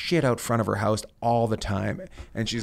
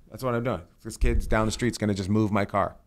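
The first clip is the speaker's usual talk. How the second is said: ordinary talk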